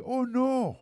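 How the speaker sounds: high startled gasp of a cry; high shocked exclamation. Acted. Host acts Elizabeth's shocked cry.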